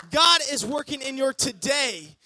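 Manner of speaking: loud, rising preacher's build; preaching. Acted. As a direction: preach it out to the church. Preaching climax.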